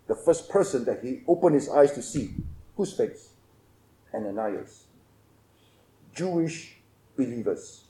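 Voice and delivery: hushed preaching. Acted. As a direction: draw the listeners in quietly. Soft intimate preaching passage.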